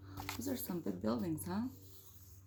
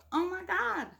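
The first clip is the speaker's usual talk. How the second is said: surprised gasp; 12 semitones higher, 3 dB louder, slower than usual. Real, not acted. Reacting to the story twist.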